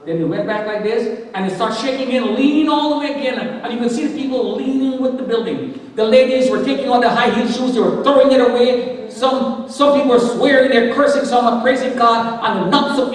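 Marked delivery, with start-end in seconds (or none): none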